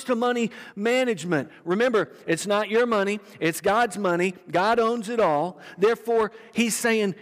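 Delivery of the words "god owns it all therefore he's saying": ordinary delivery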